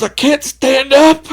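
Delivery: high, loud, slow wailing complaint; high panicked cry. Retold or imitated. Drunk telling friends he's numb, high wailing cry. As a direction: cry it like you're scared of dying. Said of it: "He goes".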